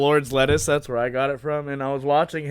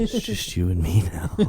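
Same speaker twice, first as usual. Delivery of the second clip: low menacing whisper-like tone; creepy killer voice; 8 semitones lower than usual. Retold or imitated. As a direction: say it like a serial killer closing in. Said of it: "repeats creepy line".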